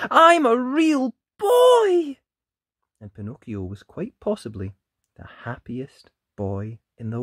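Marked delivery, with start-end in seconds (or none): 0.1-2.2 high, slow, loud joyful cry. Acted. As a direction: call it out in pure delight. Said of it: "he cried out in surprise".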